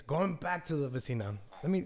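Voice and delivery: ordinary talk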